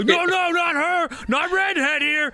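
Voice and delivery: high, eager protest; excited crowd. Acted. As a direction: squeal it like a thrilled onlooker.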